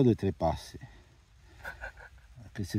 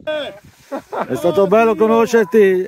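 mock dramatic farewell; 8 semitones higher, 9 dB louder, slower than usual. Real, not acted. Mock farewell on a steep descent.

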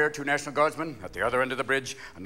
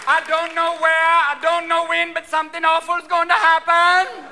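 high, loud fake Jamaican accent; psychic's prophecy in a loud mock-Jamaican accent; Miss Cleo; 11 semitones higher, 6 dB louder than usual. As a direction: draw it out in a hammed-up Jamaican accent. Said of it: "are you working with Miss Cleo?".

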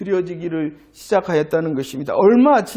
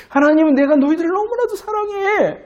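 higher-pitched emphatic declaration; God declaring love; 9 semitones higher than usual. Acted. God tells the people of great love, emphatic and high. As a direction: voice God earnestly professing love. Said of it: "이렇게 말씀하시는데".

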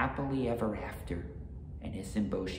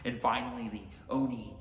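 plain reading voice

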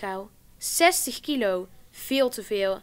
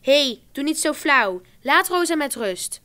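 louder, higher telling-off; sticking up for her; 4 semitones higher, 6 dB louder than usual. A narrator's reading. Karin standing up for Rosa against the girls, firm and louder. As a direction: defend her friend firmly. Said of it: "nam het voor haar op en zei".

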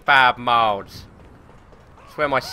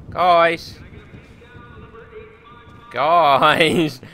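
calling out; 4 dB louder than usual. Real, not acted. Calling out to hidden enemies.

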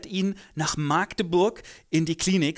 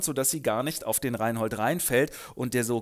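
ordinary delivery

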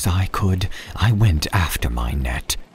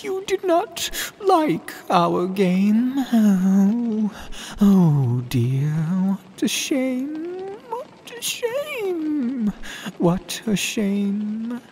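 very high, slow, fading sulky cat; sad cat; much slower than usual. Acted. Cat disappointed at the boy, high and slow. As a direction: pout over the spoiled game.

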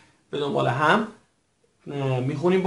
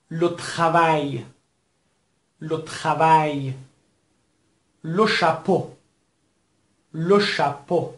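careful, evenly spaced word-list modelling; teacher modelling French words for the class to echo; repeat-after-me drill; slower than usual. Shown as a demonstration. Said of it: "حتماً به دنبال بنده تکرار بفرمایید". (تکرار شمرده کلمات برای شاگردان)

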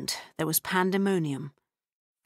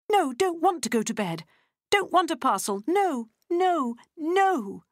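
put-on high, wailing child's refusal; tantrum; 11 semitones higher, 3 dB louder than usual. A narrator's reading. Freddie in a tantrum on the floor, high wailing. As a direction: wail it like a child in a fit.

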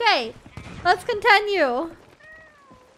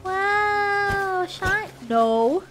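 ordinary talk

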